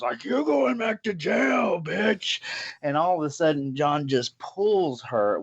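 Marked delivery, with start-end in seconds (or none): 0.2-2.4 high, drawn-out mock shout; slow, acted yell with venom; angry dad. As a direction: imitate a fed-up dad hollering a threat. Dad threatens woman in retold fight.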